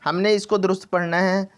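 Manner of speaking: normal talking voice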